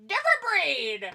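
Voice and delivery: laughing shout. Real, not acted. Mocking Pickle's scary rednecks.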